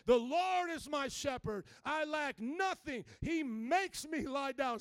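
slow, loud, emphatic proclamation; declaring in faith. Shown as a demonstration. Preacher showing the congregation how to pray, declaring boldly. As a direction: proclaim it firmly, stretching each word. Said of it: "declare some things in faith".

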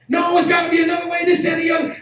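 high, fast, loud pleading protest; retold desperate shout. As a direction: yell for another way out. Friend pleads during the argument.

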